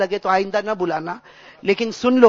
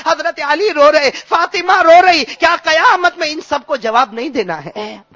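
high, loud, fervent sermon delivery; preaching at full force; 6 semitones higher, 6 dB louder than usual. Acted. Preacher stirring the congregation with a story of weeping, preaching at full force. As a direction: preach it out with swelling passion. Preacher recounts the night of tears.

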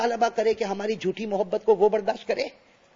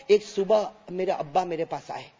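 ordinary talk, nothing marked

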